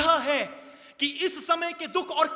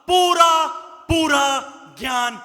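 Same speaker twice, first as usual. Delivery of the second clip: पूरा shouted much louder; emphatic shout; 9 dB louder than usual. Real, not acted. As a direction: punch पूरा out loud and hard. Preacher stressing full knowledge.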